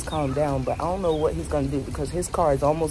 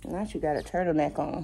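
ordinary delivery

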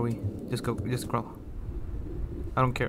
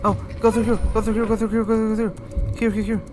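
high, frantic repeated urging; panicked urging; 8 semitones higher, 3 dB louder, faster than usual. Real, not acted. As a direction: urge yourself through in a high panic. Fleeing the monster in the game.

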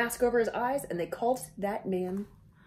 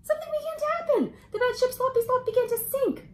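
much higher, fast alarmed storytelling; dramatic alarm; 14 semitones higher, faster than usual. A narrator's reading. Reader tells kids the ship is sinking, high and alarmed. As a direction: sound the alarm as the ship sinks.

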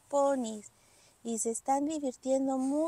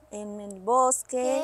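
slow, louder storyteller voice; story narration; 3 semitones lower, 4 dB louder, slower than usual. A narrator's reading. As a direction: set the new scene slowly. Storyteller scene transition. (narración lenta de cuento)

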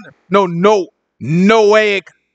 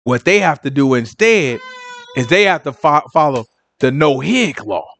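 mock explaining; 9 semitones lower than usual. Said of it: "They like".

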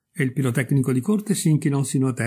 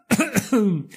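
high, acted repeated coughing; irritated cough; 9 semitones higher than usual. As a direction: cough crossly to break into the chatter. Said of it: "ripeté il Razzo con rabbia".

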